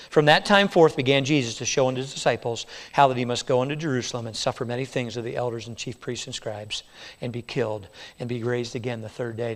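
hushed, solemn Bible reading; soft, steady scripture read-aloud. Acted. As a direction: read the verse low and quiet, almost reverent. Reads scripture softly.

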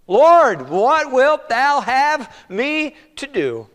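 loud, high cry, very slow and drawn out. Acted. Saul calling out to the Lord, slow loud plea.

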